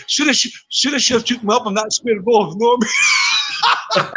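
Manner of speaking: words breaking up into laughter; laughing. Real, not acted. Teasing a teammate about the square goal, laughing.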